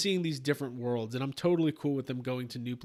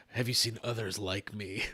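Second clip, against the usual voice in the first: deep Mando voice; 7 semitones lower, 4 dB quieter than usual. Retold or imitated. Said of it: "he always says".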